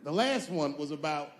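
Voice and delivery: normal talking voice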